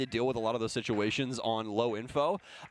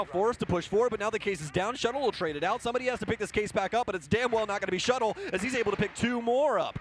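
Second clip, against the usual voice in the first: excited play-by-play; 8 semitones higher than usual. Real, not acted. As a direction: call the clutch like the crowd's on its feet. Clutch moment in live casting.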